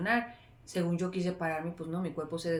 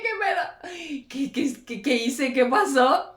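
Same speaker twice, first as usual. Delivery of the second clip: panicked disbelief; 7 semitones higher, 7 dB louder, slower than usual. Retold or imitated. Retold panic on realizing.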